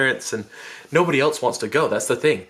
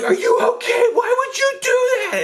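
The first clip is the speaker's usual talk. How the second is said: much higher, louder, almost crying out; distraught shout; 4 dB louder than usual. Retold or imitated. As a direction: yell in shock at the girl. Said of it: "I'm like".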